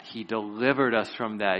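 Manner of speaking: ordinary delivery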